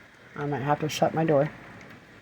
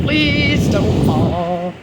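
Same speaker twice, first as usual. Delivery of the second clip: long drawn-out, loud plea; slow, anxious begging; pleading; 3 semitones higher, 6 dB louder, much slower than usual. Real, not acted. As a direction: beg slowly, stretching every word. Begging a bending tree.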